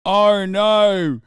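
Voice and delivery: loud, slow, hammed-up wail; mock distraught mother. Streamer quoting a famous Australian line, mock anguish. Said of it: "That's another one you can think of".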